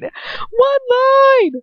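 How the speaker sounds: high, loud wail at flubbing; exasperated wail. Real, not acted. Reader wailing about ruining her streak. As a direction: cry out in mock despair. Frustrated at her own misreading.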